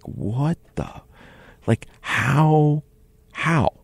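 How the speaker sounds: can't get the question out; stunned disbelief. Retold or imitated.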